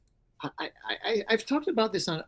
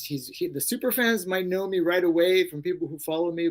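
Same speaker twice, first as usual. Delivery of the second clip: normal talking voice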